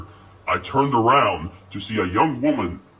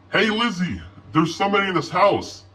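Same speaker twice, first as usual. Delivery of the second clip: shouted; 9 semitones higher than usual. A narrator's reading. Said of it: "I shouted".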